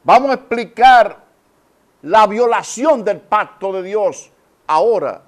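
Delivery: loud, high proclamation; forceful preaching. Real, not acted. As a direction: announce it at full force to the congregation. Preacher forcefully announces his topic. (proclamación enérgica de predicador)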